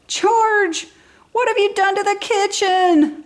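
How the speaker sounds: a mother aghast at the ruined kitchen; dismayed mother. A narrator's reading. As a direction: mom discovering the monkey's mess. Mother finds messy kitchen.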